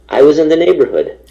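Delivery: high and loud, a sheepish fib; sheepish excuse. Retold or imitated. Caught turning up uninvited to the producer, sheepish high excuse. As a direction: play it off with an innocent excuse.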